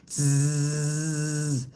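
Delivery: long buzzing "zzz" noise; sustained vibrating buzz; imitated buzz. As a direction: imitate a steady electric hum through the teeth. Imitates microphone switching on.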